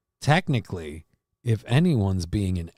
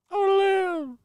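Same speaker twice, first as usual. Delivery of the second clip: much higher squeaky begging voice; high cartoon plea. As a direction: beg for your life in a squeaky cartoon voice. High put-on voice pleading.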